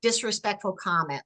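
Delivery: usual speaking voice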